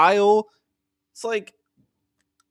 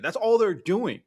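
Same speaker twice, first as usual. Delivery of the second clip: ordinary talk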